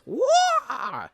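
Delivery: very high, loud mock karate cry; hammed-up karate yell. Dad clowning as a karate fighter, very high mock yell. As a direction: let out a silly martial-arts shriek. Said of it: "and he goes".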